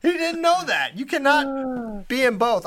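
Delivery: high, slow exasperated disbelief; incredulous. Real, not acted. Host mocking Joe Exotic's hashtags, incredulous exasperation. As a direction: spell out the obvious in disbelief.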